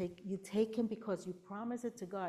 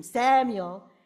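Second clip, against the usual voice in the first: loud mock scream of a name; 2 semitones higher, 12 dB louder than usual. Shown as a demonstration. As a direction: shout the name out, far too loud. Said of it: "God doesn't scream".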